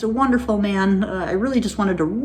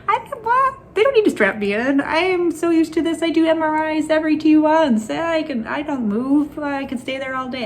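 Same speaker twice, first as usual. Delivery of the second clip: higher, incredulous, brushing it off; mock disbelief; 9 semitones higher than usual. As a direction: scoff at the idea of being strapped in. Retelling her protest at being strapped.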